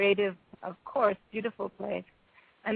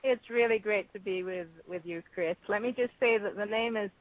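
normal talking voice

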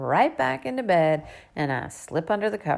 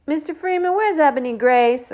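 put-on high, loud girl's call; 10 semitones higher, 8 dB louder than usual. A narrator's reading. Girl outside asking for Ebony Grace, calling out high and loud. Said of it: "a girl's voice calls from outside".

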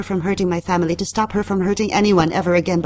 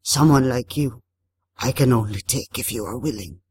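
deep, unhurried water spirit; Tarabusao; 5 semitones lower than usual. A narrator's reading. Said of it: "hoarse, waterlogged, speaking in an accent so thick".

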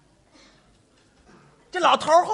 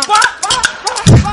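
high, loud protest; alarmed protest; 9 semitones higher, 10 dB louder, faster than usual. Real, not acted. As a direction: cut him off, startled. Protesting the insult.

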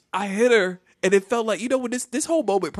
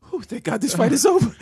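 high, loud mock relief; relieved; 8 semitones higher, 5 dB louder than usual. Boy thankful the fight was broken up, high comic relief. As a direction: blow out a "whew" and squeal thanks. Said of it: "I was so thankful for those lunch ladies".

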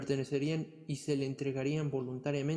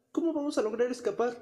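worried soldier; 9 semitones higher, 3 dB louder than usual. Acted. Fearful deserter plans escape.

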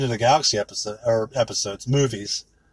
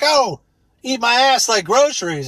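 mock female rapper; 6 semitones higher than usual.

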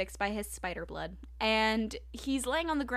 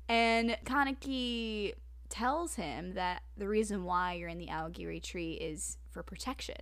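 ordinary talk

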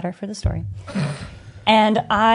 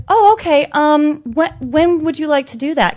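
high, polite and breezy; overly cordial; 7 semitones higher, 5 dB louder than usual. Retold or imitated. To the girl threatening a fight, sweetly cordial and high. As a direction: treat the fight threat like a booking. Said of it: "most random cordial thing".

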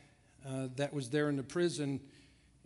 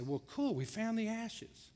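sarcastic; 7 semitones higher than usual. Acted. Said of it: "they weren't real excited".

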